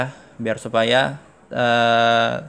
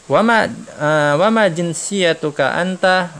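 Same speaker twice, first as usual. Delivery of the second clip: "wa ma jinsiyatuka anta?" slow, higher and louder; slow model question; 6 semitones higher, 5 dB louder, much slower than usual. Shown as a demonstration. Teacher voicing the question slowly for the class. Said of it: "si Syarif nanya ke Muhammad nih".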